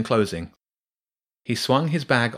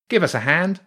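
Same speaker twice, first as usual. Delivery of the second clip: much higher rat voice straining; rat calling for help; 9 semitones higher, 4 dB louder than usual. Acted. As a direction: call your mate over to pull. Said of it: "the first voice said".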